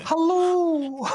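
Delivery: high, loud sing-song mock greeting; a creepy fake-friendly hello; Martha from Baby Reindeer. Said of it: "You sound like Martha from Baby Reindeer".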